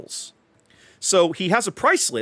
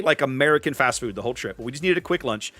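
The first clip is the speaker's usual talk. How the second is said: ordinary talk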